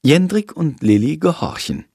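ordinary delivery

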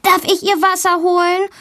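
put-on high, eager child's question; eager calling out. A narrator's reading. Valentina blurts out to her teacher, eager high voice. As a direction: call it out high like a keen pupil. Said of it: "ruft Valentina".

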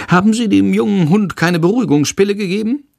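high-pitched meddling lady; officious woman. A narrator's reading. Woman passenger questions the dog's owners, high and meddling. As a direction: ask it high, as a busybody passenger.

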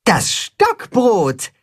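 high, stretched-out triumphant call. A narrator's reading. Katzenhuhn presents the bread dough, calling out. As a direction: draw the words out high and proud. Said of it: "ruft das Katzenhuhn".

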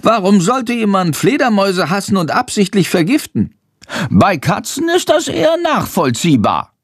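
high-pitched indignant bat voice; high, outraged creature. A narrator's reading. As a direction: protest in a high little bat voice. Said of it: "Er ist empört".

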